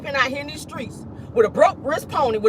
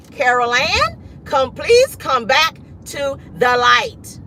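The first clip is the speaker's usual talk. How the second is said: high, slow, stretched-out summons; hammed-up film-style beckoning; mock pleading call; 4 semitones higher, much slower than usual. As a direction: plead slowly, as if coaxing someone back from beyond. Film quote, calling someone back.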